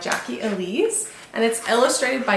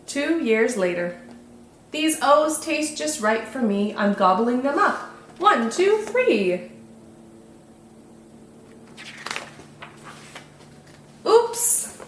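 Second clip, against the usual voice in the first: slow, sing-song picture-book narration; children's story reading; 2 semitones higher, slower than usual. A narrator's reading. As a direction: read it to little ones, savouring each rhyme.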